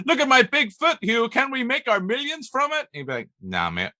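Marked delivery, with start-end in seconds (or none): 0.1-2.8 high, loud, excitable mock plea; squeaky-high pretend sales pitch; excited mock pitch. Acted pitch to Hugh Jackman. 3.4-3.9 put-on low, dismissive brush-off in a Belfast accent; lightly voiced unimpressed Belfast star; Hugh Jackman. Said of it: "why Hugh Jackman has a Belfast accent".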